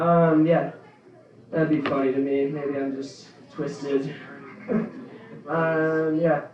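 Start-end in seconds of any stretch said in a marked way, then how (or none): none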